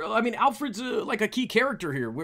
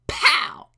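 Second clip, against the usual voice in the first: loud, explosive comic pow; explosive mock blow; shouted impact noise; 9 dB louder than usual. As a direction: burst out a punch sound. Voices comic sound effect.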